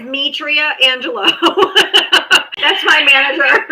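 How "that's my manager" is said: giggling through the words; laughing. Real, not acted. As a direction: laugh while saying it. Laughing, far timbre, louder.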